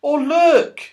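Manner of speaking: Silky calling to the others; excited call. A narrator's reading. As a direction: call out, spotting something. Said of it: "called Silky".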